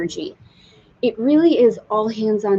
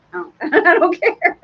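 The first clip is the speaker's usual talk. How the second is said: laughing, much higher and louder; laughing refusal; 9 semitones higher, 5 dB louder than usual. Retold or imitated. Telling husband she refuses the sail, laughing high. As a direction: laugh it out as a flat no. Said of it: "I told Dave last night".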